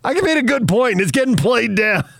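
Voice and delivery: high, whiny mock complaint. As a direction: whine it up high. Mocking a dismissed take.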